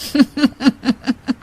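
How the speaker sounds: a short scheming villain's chuckle; sly chuckle. Acted. The scheming uncle chuckles at the sister's daughter-in-law. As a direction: chuckle to yourself over the trouble you plan.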